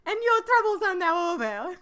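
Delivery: high, loud put-on Countess voice, laughing through it. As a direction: ham up the grand Countess. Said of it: "the Countess accent like I do".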